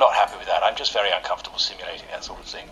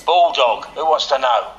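put-on hard London gangster accent, a bit louder; 2 semitones higher, 5 dB louder than usual. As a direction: tough London hard man answering the phone. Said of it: "work on your accent".

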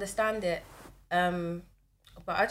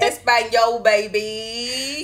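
loud, exaggerated fake-Spanish echo; mocking Spanish-accented flourish; teasing mock Spanish; 9 dB louder, much slower than usual. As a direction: parrot it loudly, stretching the words. Mimicking friend's bad Spanish, teasing.

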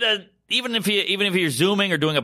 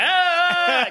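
mock scare yelp. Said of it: "I'm doing, like".

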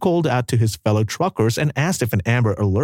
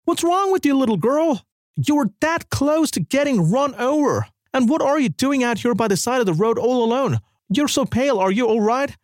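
put-on shouted at girl; 11 semitones higher than usual. A narrator's reading. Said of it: "Carl shouted".

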